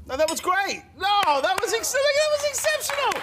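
gleeful loud praise; excited praise. Real, not acted. Praising her reaction.

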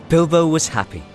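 ordinary delivery

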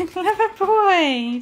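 slow, high, laughing praise for the dog; praising the dog for bringing the treat ball, high and slow. Real, not acted. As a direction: gush over the clever dog with a laugh. Praising dog after fetch.

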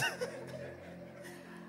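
high, airless giggle; high laugh. Real, not acted. Laughing about not pointing the gun. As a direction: burst into a breathy giggle.

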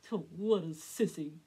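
loud slow jeering holler; hollered taunt. A narrator's reading. Drake to Elmer, loud jeer. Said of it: "Drake hollered".

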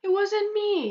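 put-on high, pleading child; squeaky protest of innocence; little boy denying it; innocent kid voice. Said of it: "you'll tell her".

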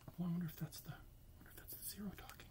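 fast, soft unvoiced whisper; whispered wondering. Real, not acted. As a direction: murmur it secretly to the listener. Whispered aside about zero.

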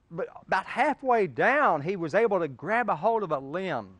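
preacher's dramatic storytelling; building story tension. Acted. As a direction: tell it with rising suspense.

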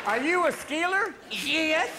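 slow, high-pitched exchange; mock interrogation. Skier being questioned about a drug scandal, mock interrogation. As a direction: stretch it out high and loud.

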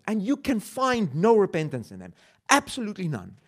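ordinary talk, nothing marked

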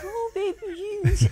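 put-on high cooing baby talk. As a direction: coo it like a baby. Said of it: "and he does the baby voice".

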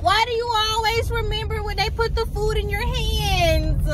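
high-pitched exasperated complaint; aggrieved high wail. Real, not acted. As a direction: whine it out in frustration, high and drawn. Exasperated at forgotten food order.

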